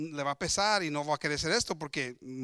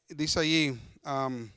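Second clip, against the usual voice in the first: usual speaking voice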